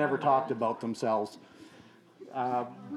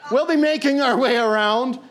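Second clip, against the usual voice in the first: higher, loud voice to the room; calling out; 5 semitones higher, 5 dB louder than usual. Real, not acted. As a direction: project to the whole hall. Addressing the crowd aloud.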